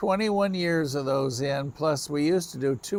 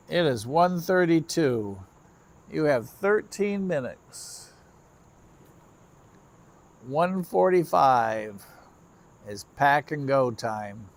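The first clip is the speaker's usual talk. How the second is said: slow deliberate; slower than usual. Real, not acted. Talking to the train.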